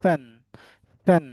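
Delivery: ফ্যান starting with aspirated p; right pronunciation. Shown as a demonstration. Teacher giving the example, ফ্যান said with ফ. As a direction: puff air at the start of ফ্যান. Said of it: "ফ বলতে হবে".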